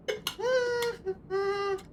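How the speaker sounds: high, thin sustained whine; pained whimpering. Real, not acted. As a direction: whine wordlessly through the pain. Suffering from hot sauce.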